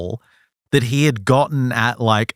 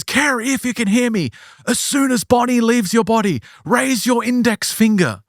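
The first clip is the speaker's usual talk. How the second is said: high, emphatic mock-authoritative instruction; theatrical hypnotist command; 9 semitones higher than usual. Doctor instructs entranced patient, theatrically commanding. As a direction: call out each instruction with authority. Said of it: "like, commanding, in control".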